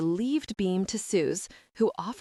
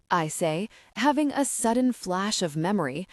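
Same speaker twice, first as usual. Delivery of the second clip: usual speaking voice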